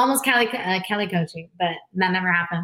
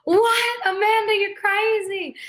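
shocked brother; 13 semitones higher, slower than usual. Retold or imitated. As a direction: gasp it out in disbelief at his sister.

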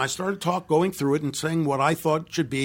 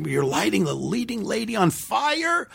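alarmed; 12 semitones higher than usual. Retold or imitated. Writer alarmed at fire scene.

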